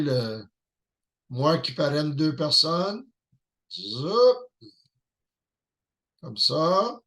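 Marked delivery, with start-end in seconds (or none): 3.7-4.4 rising whoosh-like "zoup", higher pitched; playful zoop. Real, not acted. Presenter drawing on the whiteboard for viewers, rising zoop. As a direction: slide the voice upward on a little zoup.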